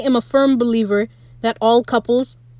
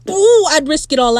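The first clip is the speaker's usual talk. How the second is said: higher-pitched, smitten "ooh"; swooning gush; 6 semitones higher, 4 dB louder than usual. Real, not acted. Fan reacting to Ed's smile, swooning. Gushing over a character's smile.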